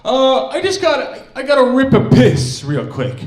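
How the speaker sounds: high, loud mock-desperate excuse; panicky teen. Cousin needing to leave the room to vape, mock frantic. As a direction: imitate a frantic kid blurting an excuse. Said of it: "wild excuse on why he needs to leave".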